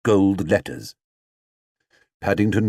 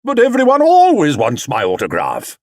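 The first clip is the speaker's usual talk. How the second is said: raised, louder disbelief; surprised; 7 semitones higher, 6 dB louder than usual. Acted. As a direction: vain star astonished. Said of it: "in a surprised voice".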